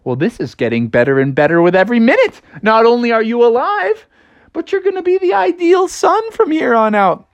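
delight climbing steadily to a high pitch; delighted mom. Acted. Mom hears of her son's promise, rising delight. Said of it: "And she's like".

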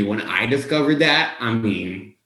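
normal talking voice

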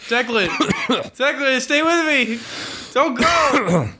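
high, pleading mock deathbed cry; dramatic deathbed plea. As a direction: beg a dying friend to stay. Mock death scene, pleading friend.

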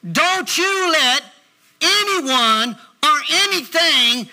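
slow, weighty preacher's declaration; punched words with big pauses between phrases; emphatic preaching. Real, not acted. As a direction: drive each phrase home to the congregation.

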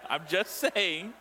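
chuckling through the words; laughing. Real, not acted. Preacher laughing as he excuses his joke. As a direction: laugh while defending the joke.

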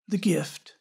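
soft, hushed, sincere ending; tender. A narrator's reading. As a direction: let the last words fall softly. Tender closing line of story.

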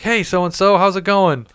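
high, bright mock-friendly phone hello; overly cheerful greeting. [name] hijacks the wrong-number call, high and chummy. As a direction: jump in like the call was meant for you.

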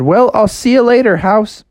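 high, loud friendly farewell; cheerful Froggy. Acted. Froggy says goodbye to the house, high and cheery. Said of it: "And so he said".

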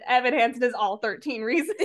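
breaking into laughter; amused, laugh bursting through the words. Real, not acted. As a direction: say it while cracking up. Cracking up at own joke.

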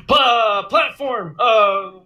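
high, heated mock rant; intense angry rant. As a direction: rant like an outraged podcaster. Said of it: "He goes, he goes like this".

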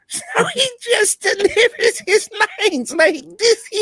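high cackling witch voice, laughing. Retold or imitated. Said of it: "he sounds like a stereotypical witch".

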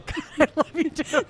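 high, laughing affection; real laughter. Real, not acted. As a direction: talk through the tail of a laugh. Cracking up at friends' answers.

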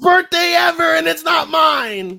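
high loud shout, slowing at the end; excited shout. Retold or imitated. Friend joking about the best birthday ever, excited shout. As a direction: yell it with mock joy.

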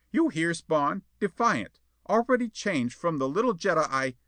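high-pitched, put-on character voice; indignant schemer. A narrator's reading. Perona denounces Jetta to Spawn, indignant. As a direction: appeal in outrage, breaking off.

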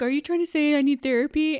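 hammed-up offended comeback; mock arguing. Joe arguing with Bill, mock indignant. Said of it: "These two immediately start arguing".

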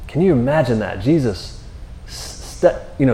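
usual speaking voice

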